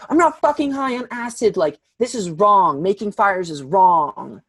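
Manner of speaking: hammed-up angry denial. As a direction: imitate an outraged friend insisting. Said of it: "she's, like, more angry now".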